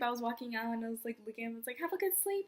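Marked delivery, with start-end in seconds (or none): 1.8-2.5 much higher parting call; calling back sweetly. Retold or imitated. Fan walking out, looking back at idol, high parting call.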